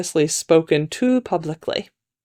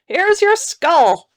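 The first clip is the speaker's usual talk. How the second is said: high, slow character voice; second man; 11 semitones higher, 4 dB louder, much slower than usual. A narrator's reading. Second man delivering a skull to the lady, high slow voice. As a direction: announce it high and drawn out.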